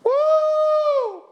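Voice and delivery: high, loud mock whoop of joy; church lady whoop. Pastor imitating a church lady who whooped at the hymn, high mock whoop. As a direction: let out a churchgoer's excited "woo". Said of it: "there was a lady in the church that would go".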